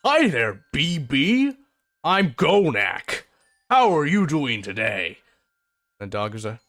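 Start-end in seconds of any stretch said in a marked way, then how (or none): 0.1-5.2 half-orc crouching to greet a timid dog; gentle. Acted. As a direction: coax a scared dog kindly. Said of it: "He squats down and says".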